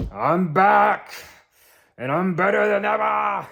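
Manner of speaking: put-on loud, high Red Guardian boast, failed Russian accent. Vlogger imitating Red Guardian, loud failed Russian accent. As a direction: bellow it like the Russian hero, accent slipping. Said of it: "That wasn't a Russian accent".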